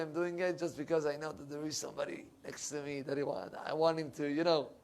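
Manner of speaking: ordinary talk, nothing marked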